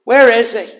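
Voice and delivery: mock the broken man, rising to a shout; taunting enemies. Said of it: "are taunting him".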